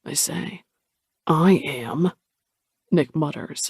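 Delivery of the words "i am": I am with I leaned on; stress on I. A narrator's reading. Nick muttering to Cole, stressing I. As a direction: hit I, then drop am. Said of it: "the emphasis on the first word".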